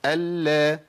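"ال" on a level note, L held then opened to a; doubled lam, same timing. Shown as a demonstration. Teacher models equal timing, doubled L with fatha. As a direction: sustain the L of "ال", release into a short a. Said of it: "نفس الزمن".

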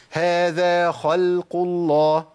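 هذا خلق الله drawn out very slowly, lam light; slow recitation. Shown as a demonstration. Teacher models the correct recitation. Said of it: "نجيب مثال تاني".

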